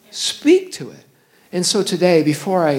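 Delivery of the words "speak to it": high, ringing command; preacher's loud charge; commanding repeat. Acted. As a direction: declare it over the mountain. Pastor repeating verse louder.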